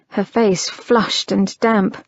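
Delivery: plain reading voice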